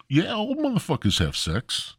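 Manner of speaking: ordinary talk, nothing marked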